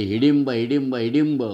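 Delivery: put-on loud singsong jeer, a child teasing; mocking taunt shout. A narrator's reading. Jayu jeering at Bimm as the girls leave, loud taunt. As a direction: chant the name to mock him, loudly. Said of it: "बिम्मला वेडावत Jayu ओरडली".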